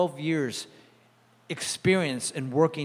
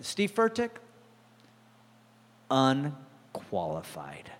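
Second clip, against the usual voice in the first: slow, low scornful dismissal; a professor's disdainful verdict; 4 semitones lower, 4 dB quieter, slower than usual. Retold or imitated. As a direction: dismiss him with contempt. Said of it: "spoke with disdain".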